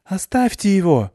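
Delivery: high, loud shout from behind. A narrator's reading. Klyusya shouts at captors to leave Anton, loud. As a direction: yell to stop them. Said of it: "крикнула откуда-то сзади Клюся".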